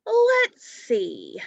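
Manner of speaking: breathy thinking-aloud dad voice, high; dad wondering. Acted. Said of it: "said Mike's dad".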